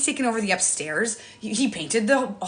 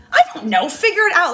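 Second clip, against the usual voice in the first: raised, louder exasperated retort; fed-up mom brushing off a question, pitched up; mock exasperation; 8 semitones higher, 5 dB louder than usual. Busy mom answering husband's offer to help, exasperated.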